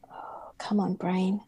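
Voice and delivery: whispered frustration at herself; whisper, urging your own brain; muttered whisper. Real, not acted. Frustrated recall.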